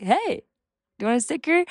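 mildly imitated sunny greeting to a stranger; bright cheery offer. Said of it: "I'll just be like".

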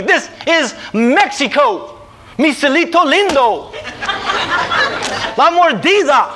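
high, ringing proclamation; slow, high, triumphant pronouncement; dramatic realization. Retold or imitated. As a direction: announce it like a grand revelation. Dramatic comic realisation of bribery.

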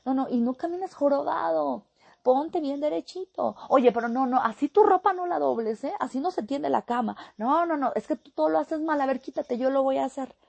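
put-on high, fast, loud scolding of a child; nagging parent. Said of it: "y le estamos diciendo".

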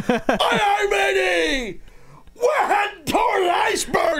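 high, shouted hammy pirate call. Acted. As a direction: bellow it like an old sea captain on deck. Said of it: "Always to the pirate thing".

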